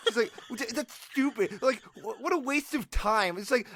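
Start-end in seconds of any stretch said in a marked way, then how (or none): 0.7-3.4 higher-pitched imitation of huffy dismissal; mock indignant brush-off; defensive. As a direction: scoff it defensively, pitch lifted. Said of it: "get so immediately defensive".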